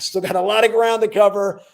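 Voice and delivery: building to a big ringside announcement; hype introduction. Real, not acted. Host announcing the next speaker, loud and rising. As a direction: boom out her name like a boxing announcer.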